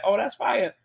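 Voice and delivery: ordinary delivery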